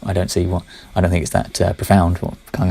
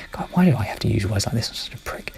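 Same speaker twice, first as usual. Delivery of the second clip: whispered aside; 7 dB quieter than usual. Real, not acted. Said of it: "self-deprecating aside".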